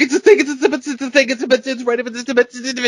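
very high, nonsensical frantic babble; stuck, stammering in high-pitched panic; panicked babbling. Retold or imitated. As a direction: imitate night-terror babbling, high and incoherent. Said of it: "I'm not making any sense".

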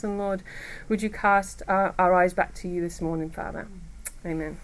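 soft, hushed prayer voice. Acted. Speaker praying softly over the ladies in the room. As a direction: pray quietly over the room.

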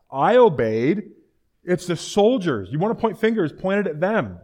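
loud, high blame-shifting outburst; defensive protest. Acted. Saul blaming his soldiers, loud and indignant. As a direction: push the blame away forcefully.

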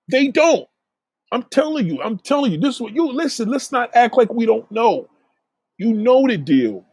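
normal talking voice

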